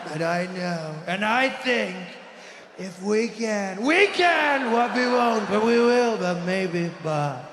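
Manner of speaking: slow speech with volume all over the place; Trump. Acted. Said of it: "The volume on his voice all over the place".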